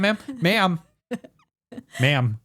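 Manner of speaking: insistent repeated calling, playfully stern; mock official reprimand. Cast member to guest with a flashlight, mock reprimand. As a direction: keep trying to get the guest's attention.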